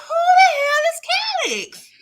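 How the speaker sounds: high, loud who the hell with a laugh; baffled outburst. Retold or imitated. Viewer baffled by the stranger, shouting with a laugh. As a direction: blurt it out in disbelief. Confused outburst about Calix.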